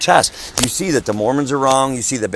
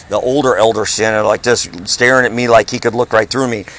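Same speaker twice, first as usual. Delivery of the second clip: ordinary talk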